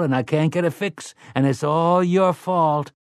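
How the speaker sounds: high, whining accusing lament; dolorous and accusatory. Acted. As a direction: moan it, blaming your brother. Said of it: "dolorous and accusatory".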